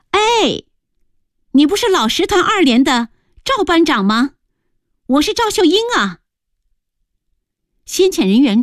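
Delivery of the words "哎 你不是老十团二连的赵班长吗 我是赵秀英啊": high-pitched call of recognition; calling out loudly. A narrator's reading. Woman hailing a squad leader she knows, calling out. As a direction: hail an old acquaintance from afar. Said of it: "大声喊道".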